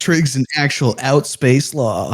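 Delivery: mock dramatic reading. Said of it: "there was one line, uh, that says".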